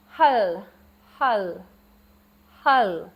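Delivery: hull said slowly and carefully with a clear h and a falling tone; teacher's careful Khmer pronunciation demo; Khmer word hull. Shown as a demonstration. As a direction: model hull for the class to repeat. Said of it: "Spicy, hull".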